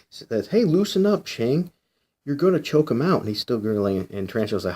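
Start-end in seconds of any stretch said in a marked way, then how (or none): 0.5-3.2 calling out. Acted. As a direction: yell at him to ease off. Hero yells to stop choking.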